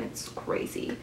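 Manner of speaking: "It's crazy" breathed as a soft whisper; hushed aside. Real, not acted. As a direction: whisper it like a secret. Aside after plot point.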